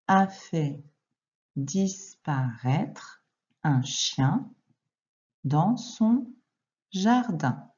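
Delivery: very slow, word by word with pauses; slow dictation. Shown as a demonstration. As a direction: dictate each word to the child. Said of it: "Deuxième phrase". (dictée lente, mot par mot)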